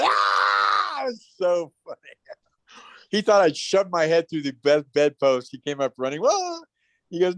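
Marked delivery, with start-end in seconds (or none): 0.0-1.0 put-on a kid's fright at the howl; startled scream. Boy hearing Bigfoot howl on intercom, scared yelp. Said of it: "And I went". 6.2-6.6 high alarmed whoa; startled whoa. Retold or imitated. Father rushing upstairs, high startled whoa.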